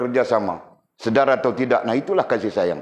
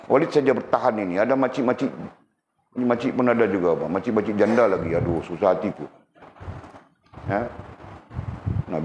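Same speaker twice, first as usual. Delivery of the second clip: ordinary talk, nothing marked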